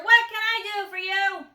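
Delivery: loud, high booming question; loud grocer. A narrator's reading. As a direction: bellow it at the customer. Loud grocer keeps talking.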